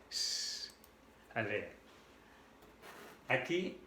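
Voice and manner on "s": long snake-like hiss; sustained voiceless sss; hissed S sound. Shown as a demonstration. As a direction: hold a plain s sound. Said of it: "hacen como la S".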